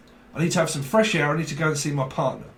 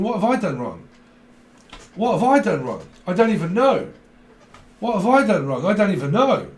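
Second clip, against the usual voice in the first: rising worried fretting, louder; anxious inner panic; 4 semitones higher, 4 dB louder than usual. Retold or imitated. Anxious partner panicking over an unanswered text, rising louder. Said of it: "as I was thinking".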